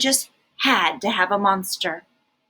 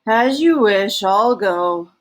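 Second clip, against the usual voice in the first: louder, resigned monster voice; Herbert monster voice; 5 dB louder, slower than usual. A narrator's reading. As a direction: give in and leave, grumpily. Herbert leaving.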